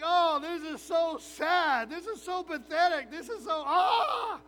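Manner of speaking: slow, loud hammed-up wail of despair; mock despair. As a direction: whine like the world ended. Despairing at the scale.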